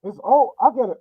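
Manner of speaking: normal talking voice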